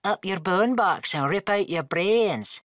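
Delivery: high, loud mock Irish accent parroting her threat; mocking Irish echo. Skull teasing the tiefling by parroting her threat in a mock Irish accent.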